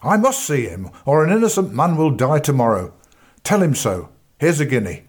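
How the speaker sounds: breathless, urgent lawman; urgent plea. A narrator's reading. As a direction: push past them, urgently. Bradbury insisting, bribing.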